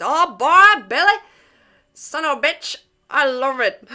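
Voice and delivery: high, loud mock Louisiana accent from Resident Evil; Louisiana accent imitation. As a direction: ham up the Louisiana accent like the Baker family. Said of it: "their accent is".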